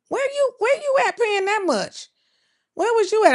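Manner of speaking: high, loud disbelieving question; astonished. Real, not acted. Vlogger asks chat where gas costs that much, astonished. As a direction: pitch way up in shock at the price. Reacting to viewer's diesel price.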